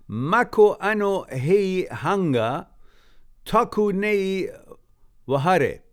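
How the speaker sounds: "Mako ano hei hanga, taku nei wahare" read slowly in Māori vowels; careful Māori reading. Shown as a demonstration. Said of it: "I try to read it".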